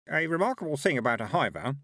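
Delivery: high-pitched reedy lecturer. A narrator's reading. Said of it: "a reedy voice began".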